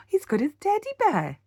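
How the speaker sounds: usual speaking voice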